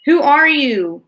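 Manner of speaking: high, loud booming question; booming demand. A narrator's reading. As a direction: demand an answer at full volume. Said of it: "boomed the voice from Houston".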